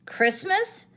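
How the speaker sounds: high, loud hoot; hooted joke. A narrator's reading. Tigger hooting to hurry his friends along. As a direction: toss it out as a bouncy joke. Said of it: "hooted Tigger".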